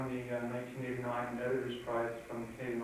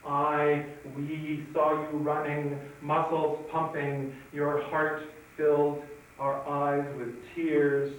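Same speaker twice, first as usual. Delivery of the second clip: slow, deliberate poem reading; poetry recitation; 3 semitones higher, 7 dB louder, much slower than usual. Acted. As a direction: declaim an anti-war poem, drawing out the phrases. Reads own poem aloud.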